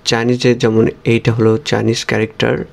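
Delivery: ordinary talk, nothing marked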